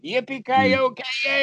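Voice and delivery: very high, drawn-out cowboy whoop; gleeful cheer. Acted. Teacher cheering the right attitude, gleeful high whoop.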